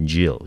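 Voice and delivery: "Geo" run together as one syllable, "jyo"; merged into one syllable. Shown as a demonstration. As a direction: glide "Geo" into a single beat. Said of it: "通常会把它念成一个音节".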